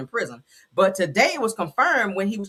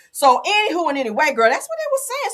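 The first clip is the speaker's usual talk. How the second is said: sing-song catchphrase; 8 semitones higher, 5 dB louder than usual. Real, not acted. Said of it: "signature catchphrase sign-off".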